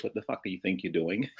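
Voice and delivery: ordinary talk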